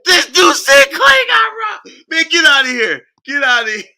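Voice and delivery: high, loud words broken by laughter; laughing shout. Real, not acted. Mocking Klay to viewers, laughing loudly. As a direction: crack up while shouting it out.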